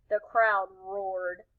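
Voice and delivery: ordinary delivery, nothing marked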